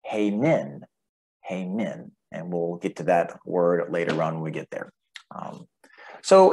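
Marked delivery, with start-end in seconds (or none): 0.0-0.8 "hey min" with the weight on "min"; second-syllable stress. Shown as a demonstration. Teacher sounding out a Greek word, "hey min" stressed on "min". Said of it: "the emphasis on the second syllable". 1.4-2.1 softer "hey min" with the weight on "min"; second-syllable stress. Shown as a demonstration. Teacher repeating a sounded-out Greek word softly, "hey min" stressed on "min". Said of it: "the emphasis on the second syllable".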